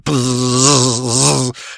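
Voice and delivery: drawn-out buzzing noise like electric clippers; shear buzz. Retold or imitated.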